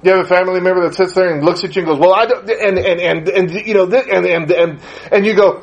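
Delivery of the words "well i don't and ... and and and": imitating a tongue-tied relative; acted verbal fumbling; stumbling over words. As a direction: stammer and restart, never finishing the thought. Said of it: "stumble over myself verbally over and over again".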